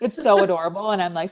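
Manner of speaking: normal talking voice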